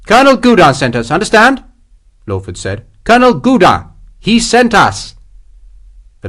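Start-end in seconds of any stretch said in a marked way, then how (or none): 0.1-1.6 loud, high-pitched insistence; insistent officer. A narrator's reading. Officer waving down protesting men, loudly insistent. 3.0-5.2 loud, high-pitched insistence; insistent officer. A narrator's reading. Officer waving down protesting men, loudly insistent.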